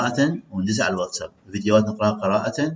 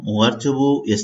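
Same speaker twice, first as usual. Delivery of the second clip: ordinary talk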